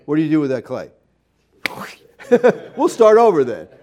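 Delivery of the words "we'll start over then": laughing it out, louder; chuckling through the punchline. Real, not acted. Preacher laughing at his own clay joke.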